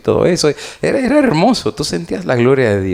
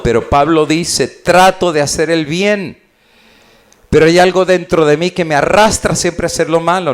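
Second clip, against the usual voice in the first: higher and louder, building sermon climax; fervent preaching; 7 semitones higher, 3 dB louder than usual. Acted. Preacher urging the congregation, louder and higher at the climax. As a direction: drive it home to the church.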